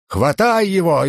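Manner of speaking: high, drawn-out narrated shout; urgent high-pitched cry; frantic shout. A narrator's reading. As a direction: shout the order high and stretched, volume held back. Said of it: "истошно закричал один из всадников".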